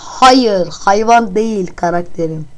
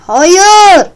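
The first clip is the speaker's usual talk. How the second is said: high loud shouted no; exasperated shout; 9 semitones higher, 10 dB louder than usual. Real, not acted. Player losing patience with the guessing game, shouted no. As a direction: yell the no in frustration. Annoyed answering.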